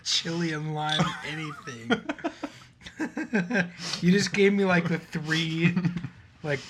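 laughing through the words; giggling and grossed out; laughing disgust. Real, not acted. Laughing disgust after tasting candy.

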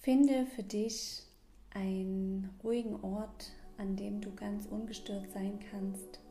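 calm breathing guide, gently paced; guided meditation. Acted. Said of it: "in diese kleine Mini-Meditation".

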